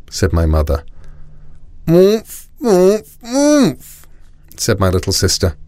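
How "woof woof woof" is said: urgent high muffled squeals; gagged. A narrator's reading. Little sister gagged with a sock trying to tell Mum, high and muffled. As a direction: plead wordlessly through a gag. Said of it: "took the sock out of her mouth".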